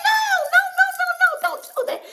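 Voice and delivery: high, loud laughing refusal; overwhelmed no no no; laughing protest. Retold or imitated. Refusing her paid bill.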